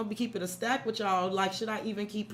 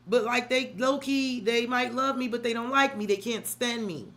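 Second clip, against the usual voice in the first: normal talking voice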